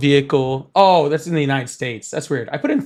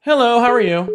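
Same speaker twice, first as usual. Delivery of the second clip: high bright call-in greeting; upbeat high hello to a stranger on the line; chirpy host greeting; 8 semitones higher, 4 dB louder than usual. Acted. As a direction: greet the caller like a game show host. Streamer greeting random callers.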